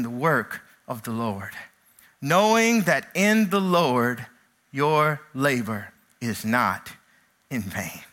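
low, slow, solemn reading; quiet scripture recitation. Acted. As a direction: read the verse with reverence.

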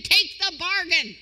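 slow, loud, high appeal; urging. Acted. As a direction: lean on each word, pleading them to take it. Preacher urging the congregation.

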